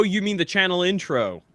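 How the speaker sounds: normal talking voice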